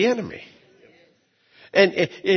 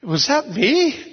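higher, slow, wondering question; astonished; 8 semitones higher, slower than usual. Real, not acted. Pastor marvelling the wind obeyed him, slow surprised question. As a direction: ask yourself in disbelief. Wonders at his own power.

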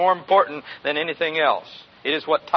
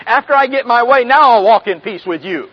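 mock selfish; 5 semitones higher, 9 dB louder than usual. Mock selfish believer's attitude.

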